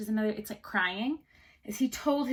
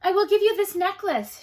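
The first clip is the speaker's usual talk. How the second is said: much higher and louder eager offer; eager girl; 9 semitones higher, 8 dB louder than usual. Acted.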